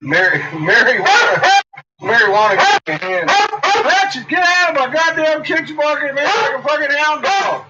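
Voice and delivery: high, loud playful chant; shooing the dog from the kitchen in a high, loud playful voice; singsong to the dog. Real, not acted. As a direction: sing it out, then shoo the dog. Singsong then scolding dog.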